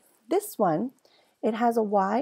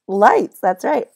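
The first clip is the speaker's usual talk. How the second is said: cheerful answer; 4 semitones higher, 10 dB louder than usual. Shown as a demonstration. Teacher gives blended answer.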